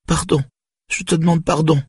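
put-on very high child's pleading; tearful apology. A narrator's reading. Daughter apologising to her father through tears, pleading. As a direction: beg forgiveness in a little girl's wail. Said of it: "Les larmes coulaient".

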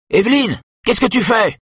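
mildly voiced cry for his sister; calling out. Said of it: "crie Joseph Eck".

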